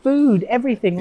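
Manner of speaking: high-pitched enthusiastic listing; playful mock excitement; imitated excited fans. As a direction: gush like an amazed fan. Voicing delighted Japanese fans' reaction.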